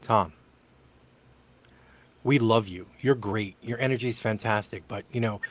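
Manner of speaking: a hint of a delicate manager. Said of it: "had that conversation to me".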